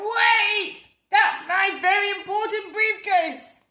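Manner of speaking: high, loud, slow shout. Acted. Mister Brown shouting after the ice cream seller.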